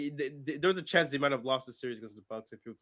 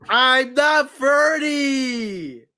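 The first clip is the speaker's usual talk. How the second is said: loud, very slow, high shout; shouted protest; 10 semitones higher, 9 dB louder, much slower than usual. Real, not acted. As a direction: drag it out in a shout. Protesting loudly, laughing.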